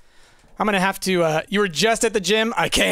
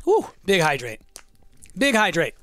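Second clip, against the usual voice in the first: ordinary delivery, nothing marked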